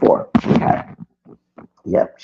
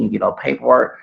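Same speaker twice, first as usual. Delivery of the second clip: normal talking voice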